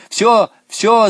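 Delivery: high, loud cry; urgent high-pitched yell; girl's shout. A narrator's reading. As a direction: call a halt excitedly. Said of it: "закричала Наташа".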